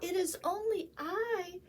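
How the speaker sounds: high squeaky little goat; small goat squeak. A narrator's reading. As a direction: squeak it out, tiny and timid. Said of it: "the littlest billy goat squeaked".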